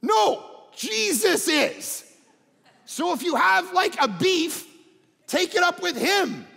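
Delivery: loud, high, emphatic rebuttal; emphatic preaching. Acted. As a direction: drive it home with force.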